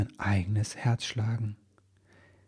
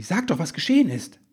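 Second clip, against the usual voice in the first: higher, louder, pressing for an answer; urging; 9 semitones higher, 6 dB louder than usual. A narrator's reading. Elf man urges her to explain what happened. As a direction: push her to tell, impatient and eager. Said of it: "drängte Ribbelwitsch sie".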